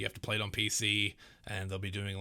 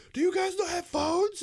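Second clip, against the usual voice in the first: squeaky mocking quote, much higher and louder; shrill parody of a clueless exec; 7 dB louder than usual. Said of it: "he was like".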